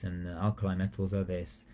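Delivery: flat, droning, lifeless delivery; monotonous voice. Shown as a demonstration. Teacher showing how a dull lesson sounds, monotonous. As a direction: drone it like a bored teacher. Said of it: "you're speaking like this".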